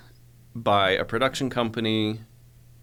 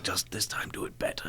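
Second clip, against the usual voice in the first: breathy whisper, much softer; 2 semitones lower, 9 dB quieter than usual. Retold or imitated. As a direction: murmur the note close to his ear. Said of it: "he whispered it".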